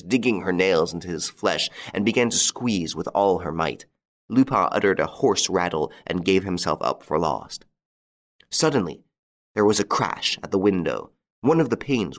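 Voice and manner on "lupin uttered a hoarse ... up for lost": ordinary delivery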